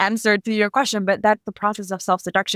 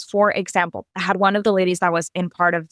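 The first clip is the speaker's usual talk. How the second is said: ordinary delivery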